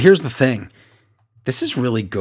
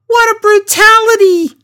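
very high, loud falsetto yell; Beth's yell; 6 dB louder than usual. Retold or imitated. Recapping the show's commentary for a co-host, high mock yell. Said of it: "And then Beth yells".

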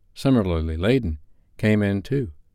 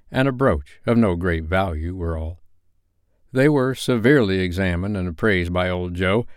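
ordinary delivery

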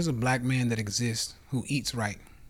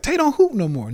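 high, loud mock whine; mocking family; 12 semitones higher, 7 dB louder than usual. Family complaining he quit basketball, high mock whine. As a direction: imitate the family's disbelieving complaint. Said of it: "To the family who's like".

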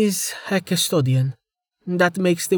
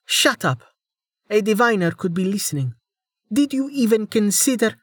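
hissed; 11 semitones higher than usual. Acted. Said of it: "hissed through her teeth".

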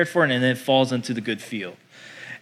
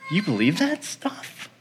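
slow, softer, scoffing disbelief; mock incredulous coworker; 4 semitones higher, 5 dB quieter than usual. Coworker scoffing at a believer at work, mock disbelief. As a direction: draw it out as an incredulous sneer.

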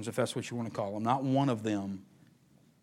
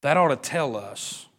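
slower spoken again at normal voice; preaching. Real, not acted. Preacher repeating the point aloud after whispering. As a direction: bring it back out of the whisper.

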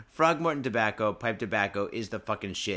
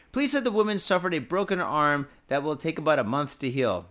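news read; 3 semitones higher, 4 dB louder than usual. Acted. Reads news story aloud.

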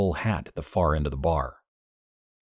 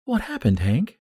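much higher, worried woman's voice; concerned June; 7 semitones higher, 3 dB louder than usual. A narrator's reading. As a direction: ask with rising worry as June.

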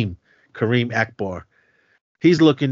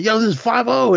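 warning call; 12 semitones higher, 5 dB louder than usual. Retold or imitated. Kid warning others of police.

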